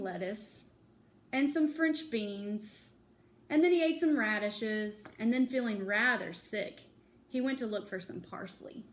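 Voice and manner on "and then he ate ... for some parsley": ordinary delivery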